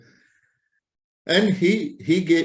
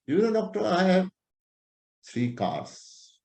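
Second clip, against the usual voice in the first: boasting patient; 7 semitones higher, 4 dB quieter than usual. Retold or imitated. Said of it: "when a patient is talking like this".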